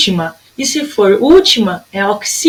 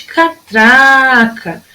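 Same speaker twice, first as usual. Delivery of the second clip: "catraca" with a long, strong "tra"; slow "catraca", swelling on "tra"; stretched middle syllable; much slower than usual. Shown as a demonstration. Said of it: "ó, no tra".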